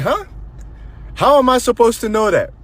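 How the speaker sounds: high, loud incredulous comeback; exaggerated disbelief, pitch jumping way up; retold indignant surprise. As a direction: act out being floored and protesting. Acted shock at mom's answer.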